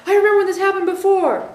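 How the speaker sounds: a flustered mind replaying the past; anxious remembering. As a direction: rush it out as a worried inner voice. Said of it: "into fear of the future".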